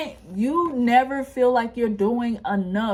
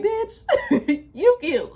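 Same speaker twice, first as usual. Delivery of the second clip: much higher, laughing through a sassy "Bitch, you cute"; sassy Venus voice; 8 semitones higher, 3 dB louder, much slower than usual. Pretend. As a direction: play Venus as a cheeky best friend hyping you up, giggling. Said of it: "Venus is gonna be like".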